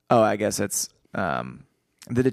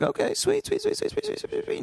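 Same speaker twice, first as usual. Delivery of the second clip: squeaky voice; 4 dB quieter than usual. Retold or imitated. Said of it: "I was just like".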